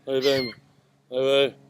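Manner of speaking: groggy morning voice; hoarse, just-woke-up voice. Pretend. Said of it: "That's my morning voice".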